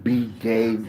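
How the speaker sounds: ordinary talk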